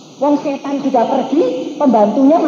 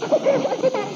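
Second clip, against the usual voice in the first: much higher, startled question; shocked maid; 13 semitones higher, 4 dB quieter, much faster than usual. Retold or imitated. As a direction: gasp it out in disbelief.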